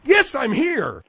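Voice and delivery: loud, slow shout; yelling. Acted. Host yells at a whispering caller, slow loud shout. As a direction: bellow it back down the line. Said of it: "What are you yelling for?".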